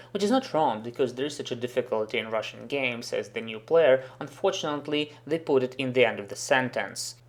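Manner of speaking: usual speaking voice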